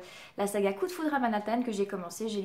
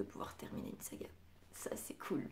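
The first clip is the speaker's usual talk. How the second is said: breathy whispered aside, much softer; 15 dB quieter than usual. Real, not acted. Booktuber whispering to viewers about finishing a saga, whispered. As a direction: drop to a whisper, pleased with herself.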